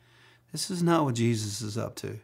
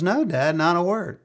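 son's answer; 6 semitones higher, 4 dB louder than usual. Retold or imitated. Son Hunter answering dad.

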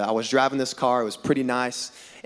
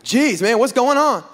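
retold alarm; 6 semitones higher, 4 dB louder than usual. Retold panic as car spins.

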